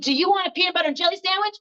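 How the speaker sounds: high, quick chirpy offer; sudden cheery switch. Retold or imitated. Upset mother abruptly offers a sandwich after "change", high and chirpy. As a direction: switch into a high-pitched, hurried sweet offer.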